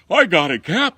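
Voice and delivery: loud, high, eager shout. Retold or imitated. Firefighter Fred shouting to his captain, eager and loud. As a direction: yell it as you charge in.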